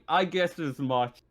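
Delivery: normal talking voice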